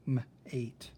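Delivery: "M, eight" split into the M sound, then "eight"; "M", short gap, "eight" with a long A; sounded-out syllable. Shown as a demonstration. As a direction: sound out "M, eight" in two separate pieces. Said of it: "break up the syllables".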